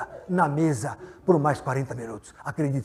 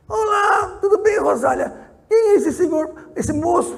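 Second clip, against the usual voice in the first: much higher and louder, mock-sweet old woman; shrill old witch; 14 semitones higher, 5 dB louder than usual. Old woman greeting Rosália, high mock-sweet witch voice. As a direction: play a sugary old witch, pitched way up. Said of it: "é uma bruxa".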